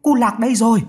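high, excited cry of discovery; calling out. A narrator's reading. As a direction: shout the good news to the house. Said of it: "Thím hô lên".

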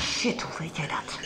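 hissed under the breath, caught out; shocked whisper. Acted. Blackmailer caught out by the gallery story, shocked whisper. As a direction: whisper it, stunned she knows.

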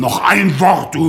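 high, loud warning; threaten, about to lash out; angry threat. Acted. Threat in a quarrel. (wütende, laute Drohung)

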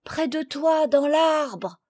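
lightly muffled, high reply. A narrator's reading. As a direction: answer as if trapped and stifled. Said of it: "crurent entendre une voix étouffée".

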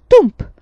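one loud, high bang; explosive wooden thud; bench's answering thump. A narrator's reading. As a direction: punch out a defiant thump. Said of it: "rispose la panca".

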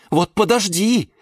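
high, sharp warning; high, huffy outburst; indignant threat. A narrator's reading. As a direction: threaten in high-pitched outrage. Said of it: "задохнулась от возмущения".

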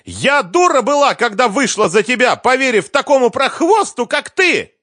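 high, loud shriek of regret and insult; furious screaming. A narrator's reading. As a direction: hurl it at the ex-husband. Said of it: "снова закричала Лиза".

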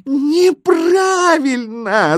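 very high, loud, drawn-out wail; piercing long howl of rejection; yelling. A narrator's reading. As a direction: shriek the verdict slowly. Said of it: "завопили знаки".

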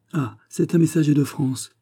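mild exclamation. A narrator's reading. Miss Stuart announcing a messenger to the king, lightly excited. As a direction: announce a visitor with a touch of excitement.

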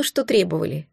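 usual speaking voice

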